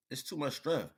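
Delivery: ordinary delivery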